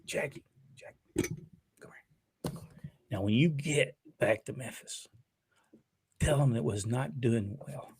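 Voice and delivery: hushed, confidential voice, barely voiced; very soft, half-whispered impression; Nick. Imagined Nick quietly calling Jackie over, hushed impression. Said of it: "I can already hear Nick right now".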